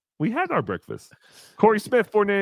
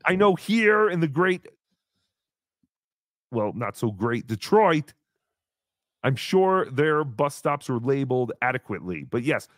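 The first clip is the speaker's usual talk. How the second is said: ordinary talk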